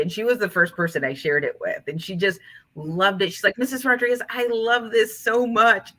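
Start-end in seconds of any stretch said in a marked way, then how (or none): 3.6-5.9 higher-pitched, heartfelt gush; gushing student. Retold or imitated. Student to teacher after hearing her book, gushing. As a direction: imitate an adoring kid, drawing out the praise.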